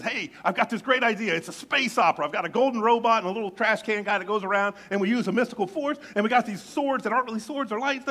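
selling his big idea, voice up and loud; excited pitch. Retold or imitated. George Lucas pitching to the studio, excited. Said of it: "he's so excited".

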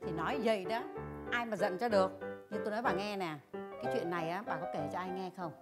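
very soft, low-pitched voice; hushed confiding. Acted. Neighbour asks if friend told anyone, hushed and secretive. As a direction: lean in and confide it quietly.

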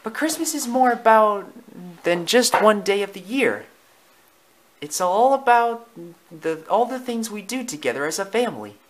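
high, enthusiastic mother dog; earnest Lady. Acted. Lady explains family Christmas to Tramp, high and earnest.

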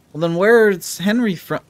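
ordinary talk

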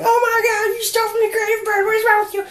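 very high squeaky mock outrage; high mock freakout. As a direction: shriek it like an outraged fan. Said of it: "don't freak out going".

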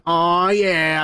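ordinary talk

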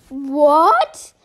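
very loud stunned "what"; loud gasp of disbelief; shocked shout. Pretend. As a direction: cry out at terrible news. Hears Bluey has one day left.

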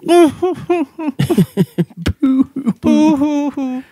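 high, mock boo-hoo at the fans. Teasing losing fans with a high mock boo-hoo. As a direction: fake sob at rival fans. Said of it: "we say to those fans".

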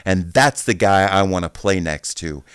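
loud, high victory shout; fired-up celebration of a teammate; triumphant yell. Retold or imitated. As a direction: crow over the big hit. Celebrating teammate's big tackle.

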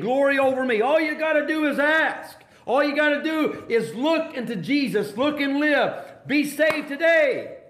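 high-pitched, insistent altar call; urgent preaching. Real, not acted. Preacher urging the lost to be saved today, urgent. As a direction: lift the pitch and drive each appeal home.

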